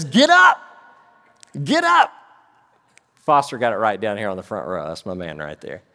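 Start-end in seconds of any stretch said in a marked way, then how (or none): 0.0-2.1 high, full-voiced shout; shouted command. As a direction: shout it as an urgent wake-up order. Acting the angel's command, the punchline.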